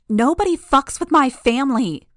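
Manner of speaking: furious shout. Acted. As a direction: scream it with rage. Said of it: "she shouted".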